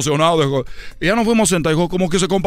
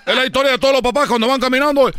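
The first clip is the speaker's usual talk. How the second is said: normal talking voice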